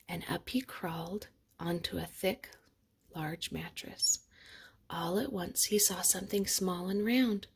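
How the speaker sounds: plain reading voice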